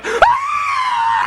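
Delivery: high loud shriek; loud high mock scream; startled yelp. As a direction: jump out of your skin. Mom startled by sneeze.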